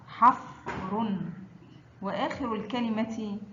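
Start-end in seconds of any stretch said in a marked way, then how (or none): 0.1-1.2 حفر very slow, split after حف; sukun mid-word. Shown as a demonstration. Teacher models fa mid-word, حفر very slow and split. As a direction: stretch حفر, resting on the vowelless ف. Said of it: "وسط الكلمة مثل".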